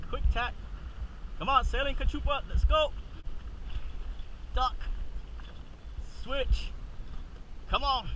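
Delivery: ordinary delivery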